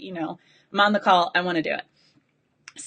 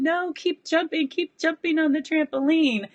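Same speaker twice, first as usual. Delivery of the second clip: higher, insistent self-urging; urging herself; 8 semitones higher than usual. Retold or imitated. To herself during the exercise, higher and insistent. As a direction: push yourself back to the happy picture.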